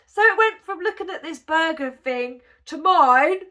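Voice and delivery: ordinary talk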